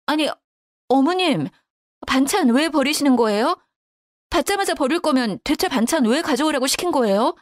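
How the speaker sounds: acted exasperated confrontation; indignant demand. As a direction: demand an explanation from mother-in-law. Acted quarrel over discarded food.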